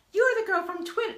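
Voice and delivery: high, loud mock voice for him; exaggerated imitation. Pat recognising the girl from Twitter, hammed-up high voice. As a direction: put on an over-the-top, high-pitched voice. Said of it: "No, he doesn't speak like that".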